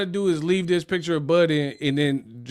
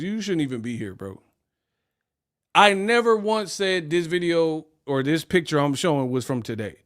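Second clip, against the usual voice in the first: ordinary delivery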